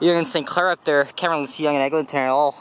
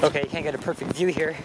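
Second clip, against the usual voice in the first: ordinary talk, nothing marked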